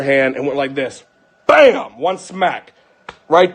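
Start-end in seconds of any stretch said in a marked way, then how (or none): 1.5-2.0 loud, high exclamation; punched impact word. Retold or imitated. Father re-enacting one smack on the back, punched exclamation. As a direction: burst it out like a single blow.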